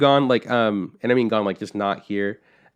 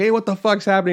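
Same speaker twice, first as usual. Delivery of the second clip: higher, louder imitation of a frantic dad; alarmed dad; 10 semitones higher, 4 dB louder than usual. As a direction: play the panicked father, pitched up.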